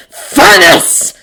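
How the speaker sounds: loud, high shout. A narrator's reading. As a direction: shout it with force. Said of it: "Bucky shouts".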